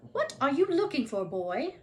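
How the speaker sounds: Madame Pince suspicious. A narrator's reading.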